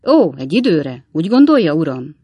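lightly mock his master's words; slightly mocking. A narrator's reading. Sam doubting the master's 'for a while', slightly mocking. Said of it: "kissé gúnyos hangon".

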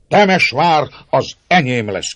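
loud, high-pitched furious outburst; angry cry. A narrator's reading. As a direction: cry it out in mounting fury. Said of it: "kiáltott György még nagyobb indulatban".

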